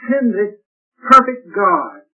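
usual speaking voice

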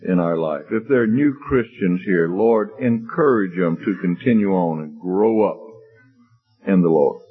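low, measured congregational prayer. Real, not acted. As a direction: earnestly address God with long pauses. Leads closing prayer.

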